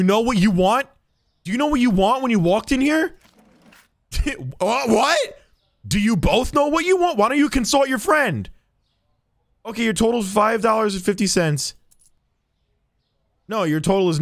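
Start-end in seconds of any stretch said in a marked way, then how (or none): none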